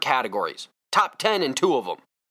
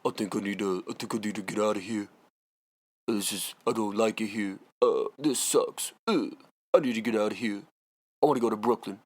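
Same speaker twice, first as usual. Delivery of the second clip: mock James Harden; 6 semitones lower, 5 dB quieter than usual. Mocking James Harden wanting out, low soft sulky mumble. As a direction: lower and softer, mumbled sulky voice. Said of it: "he would talk like this".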